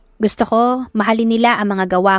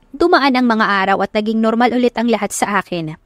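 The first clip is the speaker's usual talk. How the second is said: ordinary delivery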